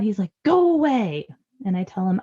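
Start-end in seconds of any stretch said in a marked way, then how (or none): 0.4-1.3 higher, drawn-out mock yell; imitated mad child. Mom imitating her so-mad eight-year-old, angry drawn-out cry. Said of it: "sometimes he's so mad".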